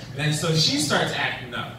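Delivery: ordinary talk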